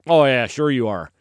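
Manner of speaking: sarcastic put-on voice, falling away; mocking doubters. Everybody doubting David's boast, sarcastic. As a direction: humor him with mock agreement. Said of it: "And everybody's like".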